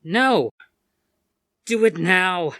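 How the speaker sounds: loud, high cry; desperate shout. Acted. Alice and Rain shouting over each other, desperate. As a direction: shout it with mounting panic. Escalating standoff.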